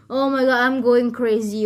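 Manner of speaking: ordinary delivery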